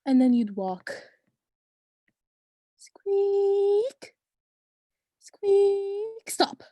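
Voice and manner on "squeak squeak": high rising squeak sound; squeaky wheel noise. Retold or imitated. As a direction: mimic the cart wheel squeaking. Imitating cart wheel squeak.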